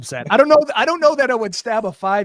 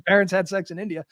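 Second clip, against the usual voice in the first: ordinary talk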